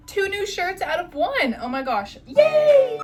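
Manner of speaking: high, loud happy cheer; excited. Real, not acted. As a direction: squeal with delight. Celebrating the new tops.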